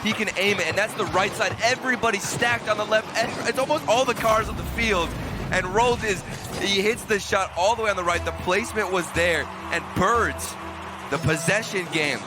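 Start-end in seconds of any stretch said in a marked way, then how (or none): 0.0-7.8 excited. Real, not acted. Color caster excited after goal.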